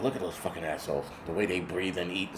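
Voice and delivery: put-on low, quiet, fast old-man grumble; grumpy old man. Old people scorning his generation, mock grumble. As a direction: sneer at the kids like a cranky geezer. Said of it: "They were like".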